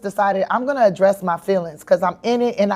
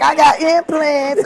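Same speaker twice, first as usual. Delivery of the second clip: drawn out, a touch higher insistence; slow defensive protest; 7 semitones higher, 3 dB louder, slower than usual. Retold or imitated. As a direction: insist slowly, half joking. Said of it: "I'm like".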